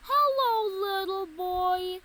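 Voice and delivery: loud booming giant greeting; big loud giant voice. A narrator's reading. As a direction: boom it out like a friendly giant. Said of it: "with his big, loud voice".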